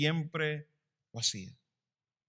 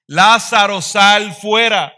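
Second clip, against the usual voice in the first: high, drawn-out preacher's shout; powerful slow shout, far louder than speech; loud commanding cry; 6 semitones higher, 11 dB louder, slower than usual. Acted. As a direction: call the dead out at full voice. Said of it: "se queda corto mi tono de voz".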